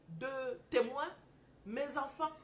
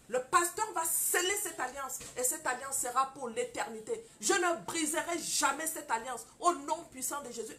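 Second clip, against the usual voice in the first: loud, fervent prayer over the ring, building to cries; 6 dB louder than usual. Retold or imitated. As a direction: pray it out with full conviction, like in church. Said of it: "j'ai dit".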